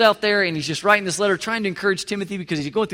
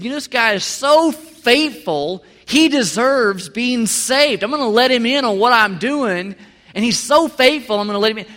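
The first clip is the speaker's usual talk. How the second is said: higher, louder, slow God musing on his faithful man; mock God voice; 4 semitones higher, 6 dB louder than usual. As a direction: play God as pleased and impressed. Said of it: "God looked down and said".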